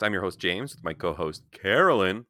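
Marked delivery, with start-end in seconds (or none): 1.5-2.3 "Carolyn" said higher and louder, with the stress on an unusual syllable; odd emphasis on her name. Real, not acted. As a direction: hit an unexpected syllable of "Carolyn" and make it ring out. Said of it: "change the emphasis on every time I pronounce your name".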